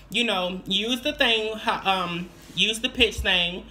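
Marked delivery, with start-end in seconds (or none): none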